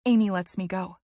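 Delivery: usual speaking voice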